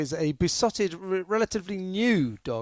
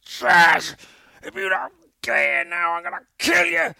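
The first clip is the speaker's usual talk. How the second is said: shouting at the dog; 2 semitones higher, 6 dB louder than usual. Retold or imitated. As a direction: yell for the dog at the top of your voice. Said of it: "at the top of my voice".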